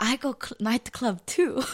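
put-on playful Korean-accented mom boast. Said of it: "in Korean, like".

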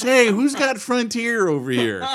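high-pitched, drawn-out mock holler; mock calling out. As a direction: pretend to hail the neighbours, high and slow.

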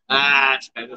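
ordinary talk

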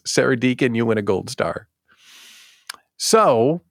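ordinary talk

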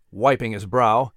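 ordinary delivery, nothing marked

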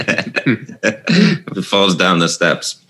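words through laughter; giggling while talking; laughing. Real, not acted.

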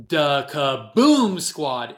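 drawn-out KABOOM with a loud boom; booming announcer-style name. Pretend. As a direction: boom out "Kaboom" long and slow. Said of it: "Can you say it like that again?".

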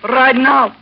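loud pushy insistence; insistent loud demand. Acted. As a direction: raise the voice to force his hand. Woman threatens to make trouble.

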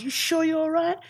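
high-pitched imitated double-check. Star checking on the writer he picked up, high imitation. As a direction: ask again in a high, imitating voice. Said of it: "He goes".

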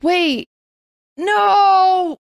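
high loud sudden cry; suddenly remember in alarm; surprised. Real, not acted. Sudden realization, shouting.

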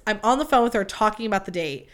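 usual speaking voice

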